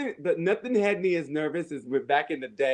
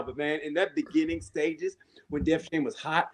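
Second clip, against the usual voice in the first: normal talking voice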